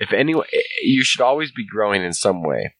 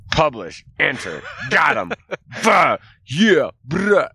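high, punchy victory exclamations; hammed-up cocky celebration, each word tossed out separately; mock triumphant; 8 semitones higher, slower than usual. Writer gleefully hitting publish on a rushed article, mock triumphant.

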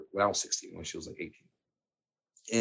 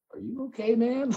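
much higher, worried "are you okay" from the next row; mock a puzzled passenger checking on him; concerned stranger; 13 semitones higher than usual. Strangers on plane staring, concerned.

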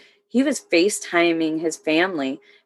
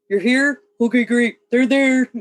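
higher-pitched imitation of a radio operator; radio person; 6 semitones higher than usual. Soldier replaying her radio job in the TOC, imitated operator. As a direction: lightly act out taking radio check-ins. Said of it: "just like a radio person".

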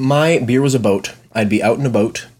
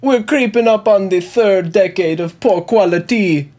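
higher, lightly held fake Jamaican accent; a bad impersonator's Jamaican accent, a bit higher; mock Jamaican accent; 5 semitones higher than usual. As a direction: keep the goofy Jamaican voice going. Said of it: "I'll do Mike trying to do a Jamaican accent".

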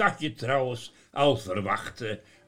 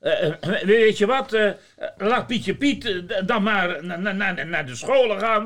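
put-on stammering old Sinterklaas; 8 semitones higher, 5 dB louder than usual.

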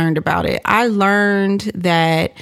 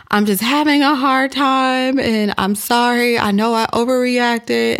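higher-pitched imitated remorse; retold apology; 6 semitones higher than usual. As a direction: imitate a stressed woman apologizing. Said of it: "she then apologizes to me".

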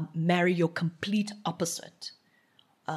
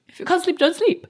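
much higher, louder, delighted repeat; excited echo; 12 semitones higher, 9 dB louder than usual. Real, not acted. Repeating her partner's advice, excited and high. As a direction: echo it back thrilled.

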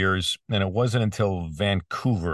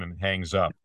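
usual speaking voice

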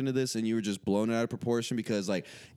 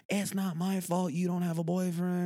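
mocking sister quote; 5 semitones higher than usual. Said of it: "It's like".

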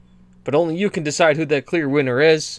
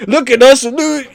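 announcer; 9 semitones higher, 4 dB louder than usual. Pretend. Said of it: "just say".

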